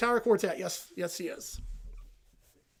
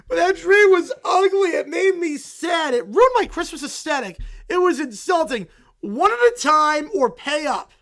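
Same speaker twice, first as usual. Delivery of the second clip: loud, higher clamour; shouting over each other; 6 semitones higher, 8 dB louder than usual. Acted. Kids and Lucy shout over each other at the booth. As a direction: yell to be heard over the others.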